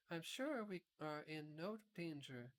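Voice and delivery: put-on calm, serious reassurance from the girl; sober Dorothy. A narrator's reading. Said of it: "said Dorothy in a sober voice".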